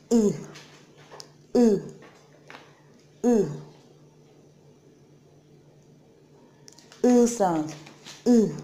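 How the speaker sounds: unrounded "U" said flat and clear; Korean vowel eu. Shown as a demonstration. Teacher drilling the tricky "U". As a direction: model the hard "U" with spread lips. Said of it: "this particular sound is really hard to pronounce".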